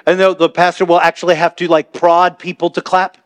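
ordinary talk

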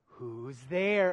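patient low setup reply; dad playing along. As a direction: play along patiently with a kid's joke. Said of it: "And I'm like".

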